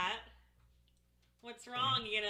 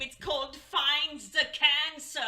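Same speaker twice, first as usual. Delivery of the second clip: slow, louder game-show host in a bad German accent; 3 semitones higher, 5 dB louder than usual. Game-show host in bad German accent. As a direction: announce it like a cheesy German host, slowly. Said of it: "my shitty German accent".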